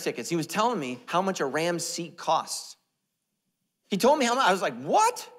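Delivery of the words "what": high incredulous squeak; shocked. Retold or imitated. Pastor retelling hearing the seat price, shocked. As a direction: jump way up in disbelief.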